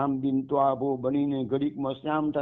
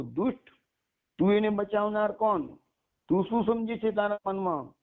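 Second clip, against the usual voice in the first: angry snake; 7 semitones higher than usual. Acted. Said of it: "ફૂફાડો મારતો".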